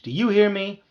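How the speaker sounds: high, loud demand; screeching. A narrator's reading. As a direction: screech it to be heard. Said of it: "I screeched".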